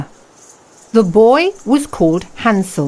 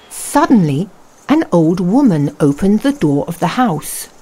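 plain reading voice

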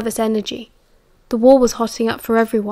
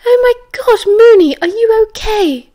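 high, loud cry of horrified alarm; 11 semitones higher, 6 dB louder than usual. A narrator's reading. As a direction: cry out in horror, pitched way up.

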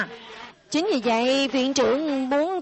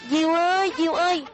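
calling out; 3 semitones higher than usual. Acted. Students searching for their lost kite, calling out. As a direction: call into the distance for the kite.

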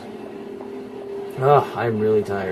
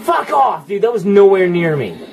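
high, loud indignant yell; real exasperated outburst; outraged protest; 9 semitones higher, 6 dB louder than usual. Real, not acted. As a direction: shout down an unfair call. Protesting an unfair hit.

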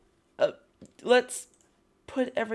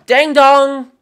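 loud sung two-note ding dong, pitched up; doorbell ding dong; 6 semitones higher, 15 dB louder than usual. Pretend. Player calling at a villager's door as a loud singsong doorbell. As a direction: ring the bell with your voice, playfully.

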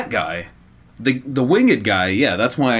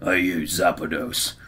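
gruff Cockney; 3 semitones lower than usual. Acted. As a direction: read it as a gruff Cockney sailor. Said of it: "gruff Cockney voice".

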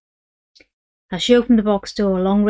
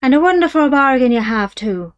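higher, louder soothing Irish patter; reassuring Irish dealer; 5 semitones higher, 7 dB louder than usual. Acted. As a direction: reassure the buyer with a wink. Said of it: "the Irishman reassured her".

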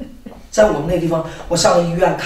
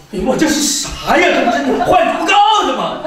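put-on startled old man; 6 semitones higher than usual. Preacher voicing old Liang confronted with babbling, comic startled outcry. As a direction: imitate a bewildered newcomer recoiling at the noise. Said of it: "就是老梁头当时".

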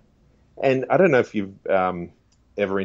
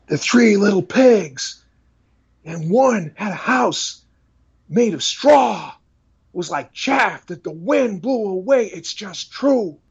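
impassioned preacher, high and drawn out; Paul Washer; 11 semitones higher, 4 dB louder, slower than usual. Acted. Preacher reading a bedtime story to his children, impassioned. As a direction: preach a bedtime story with full passion, slowly. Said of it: "did a little bit of his voice".

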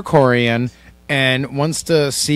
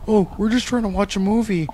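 lightly put-on meek complainer; soft, slightly raised mock complaint; mocking imitation; 4 semitones higher, 4 dB quieter, slower than usual. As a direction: quietly mimic a timid complainer. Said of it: "sat right there in front of you and acted like".

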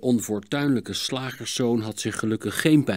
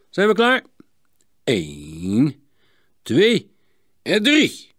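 starter's countdown; 3 semitones higher, slower than usual. A narrator's reading. Klinkhamer starts the skating race, counting off. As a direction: count the racers off with building force.

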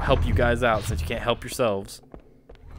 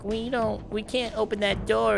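Marine voice; 10 semitones higher, 5 dB quieter than usual. Retold or imitated. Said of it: "they're gonna be like".

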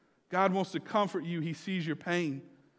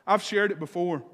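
normal talking voice

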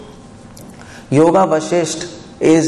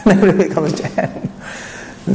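breathy, slowed words through a laugh; 3 semitones higher than usual. Real, not acted. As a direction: laugh your way through the line. Joking about Ramayan versions, laughs.

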